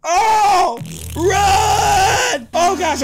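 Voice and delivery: high, loud scared cry; panicked shout. Acted. Player spots a wild wolf, panicked shout. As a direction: yell it like a wolf just jumped out.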